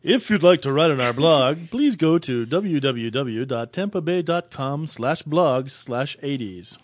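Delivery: slow, drawn-out radio-host plug; sexy podcast voice. Pretend. Co-host telling listeners where the blog is, sexy podcast voice. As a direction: read the web address slowly and seductively, playing it up. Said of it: "That's kinda what you sound like on iTunes".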